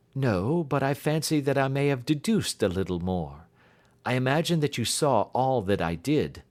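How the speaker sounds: confident detective reassuring a frightened client; detective's voice. Acted.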